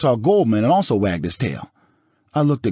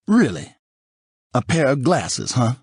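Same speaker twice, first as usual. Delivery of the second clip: sarcastic; 6 semitones higher than usual. A narrator's reading. Jealous husband doubts visitor.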